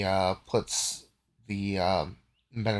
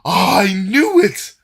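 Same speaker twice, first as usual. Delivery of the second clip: high, loud, drawn-out triumph; hammed-up gleeful accusation; Delgar crowing; 9 semitones higher, 14 dB louder than usual. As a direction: crow it slowly at full volume. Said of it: "Delgar is like".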